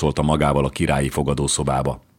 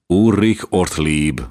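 ordinary delivery, nothing marked

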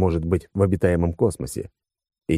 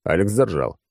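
ordinary delivery

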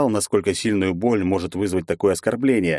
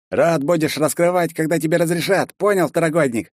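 raised, snarling threat; growling guard; 9 semitones higher than usual. A narrator's reading. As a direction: growl it at the newcomer. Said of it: "рыкнул он".

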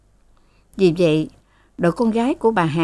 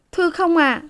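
high, meek denial; meek maid; 11 semitones higher than usual. A narrator's reading. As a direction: deny humbly, pitched high. Maid denies. (giọng cô hầu chối khẽ, cao)

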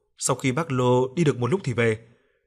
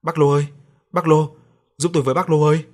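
raised, louder cry to a neighbour; higher, louder shout for help; loud call for help; 4 semitones higher, 7 dB louder than usual. A narrator's reading. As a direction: call out urgently at the neighbour's door. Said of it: "chạy qua nhà bác Lô kêu to".

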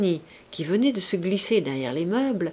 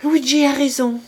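higher, lighter voice for Clorinde; young woman's voice; 7 semitones higher, 7 dB louder than usual. Acted. Clorinde siding with Luigi, in a higher, brighter voice. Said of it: "dit-elle".

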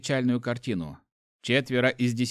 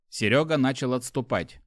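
ordinary delivery

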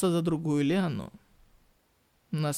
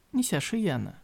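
plain reading voice

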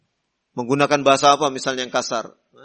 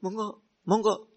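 much softer, humble host urging the guest to eat; polite Javanese invitation; 7 dB quieter than usual. Retold or imitated. Host urging a guest to try the simple meal, soft and courteous. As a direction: coax the guest gently to taste the food. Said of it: "Nah, bahasanya dicicipi".

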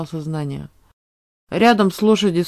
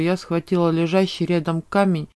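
ordinary delivery

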